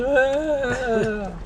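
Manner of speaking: high mock wail; hammed-up bear sobbing that nobody loves it; crying bear voice. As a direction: whimper like a sad bear.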